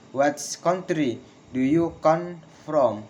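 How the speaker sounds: slow, split into chunks, "from" falling; slow falling question. Shown as a demonstration. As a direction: dictate the question in pieces. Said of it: "Failing intonation in with, in questions".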